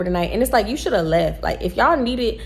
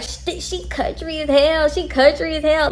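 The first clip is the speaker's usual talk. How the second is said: imitating Lucy laughing; 7 semitones higher than usual. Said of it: "And she was like".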